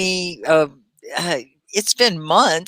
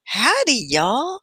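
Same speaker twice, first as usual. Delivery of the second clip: Texas drawl; 8 semitones higher, slower than usual. Shown as a demonstration. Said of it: "we say words like".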